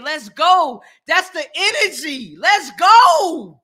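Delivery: hyped shouting to viewers; hype. Real, not acted. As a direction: pump up the chat, rising to a shout. Hyping up commenters live.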